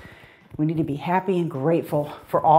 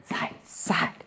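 very soft, voiceless step count; whispered cue; 13 dB quieter than usual. Real, not acted. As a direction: whisper the dance steps. Cueing steps under breath.

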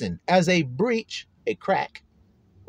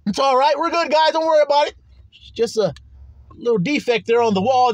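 mock reassurance; 6 semitones higher, 3 dB louder than usual. False reassurance as wall cracks.

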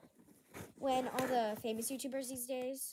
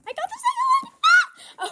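much higher and louder, squealing with joy; excited squeal; 16 dB louder than usual. Real, not acted. Vlogger finding the second book, squealing with joy. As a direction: shriek it out, overjoyed. Thrilled to get the sequel.

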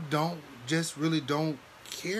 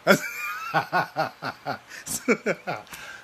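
long laugh; 3 semitones higher than usual. Real, not acted. Laughing hard at the memory of the hospital. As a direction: laugh it out fully.

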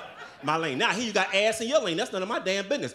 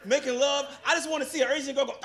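ordinary talk, nothing marked

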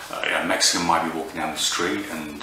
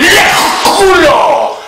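high, loud sudden yell; screeching involuntary curse; shouted swear; 13 dB louder, slower than usual. Pretend. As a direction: blurt it out uncontrollably. Mock tic outbursts of swearing.

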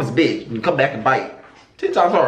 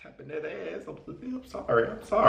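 hushed aside; 2 semitones lower, 11 dB quieter than usual. Real, not acted. Sudden quiet aside.

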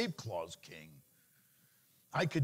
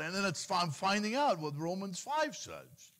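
ordinary talk, nothing marked

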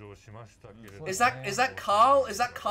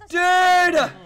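loud, high exclamation; real overwhelmed shout; excited yell; 5 semitones higher, 7 dB louder than usual. Real, not acted. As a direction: holler it through a laugh. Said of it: "I'm yelling too much".

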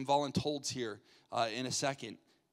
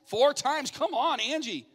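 loud, higher-pitched encouragement; rousing game-host cheer; excited cheering on; 9 semitones higher, 6 dB louder, slower than usual. Real, not acted. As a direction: cheer the contestant on.

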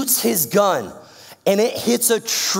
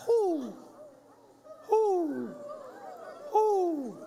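high hooting owl call; 14 semitones higher, 10 dB quieter, much slower than usual. Retold or imitated. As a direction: hoot like an owl on the field. Imitating the owl mascot.

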